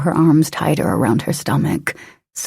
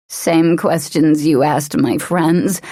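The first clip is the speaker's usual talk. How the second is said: ordinary delivery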